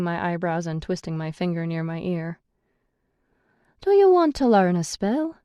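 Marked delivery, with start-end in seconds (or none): 3.8-5.4 high, coaxing lilt. A narrator's reading. Brennis tempting McCree with a spell, coaxing. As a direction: tempt her with the offer. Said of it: "asks me coaxingly".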